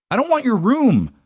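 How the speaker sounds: high, loud angry hiss. A narrator's reading. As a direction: spit it at him furiously. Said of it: "I hissed".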